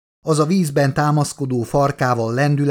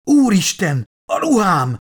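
higher-pitched panicked cry; alarmed howl; 7 semitones higher, 3 dB louder than usual. A narrator's reading. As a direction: cry out in horror. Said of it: "üvöltötte a biológus".

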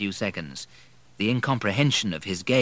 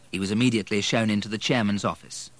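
ordinary delivery, nothing marked

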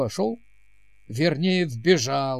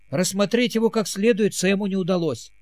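usual speaking voice

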